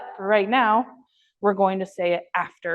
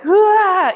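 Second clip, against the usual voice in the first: mock excited dog yelp; 10 semitones higher, 8 dB louder than usual. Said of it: "he's like".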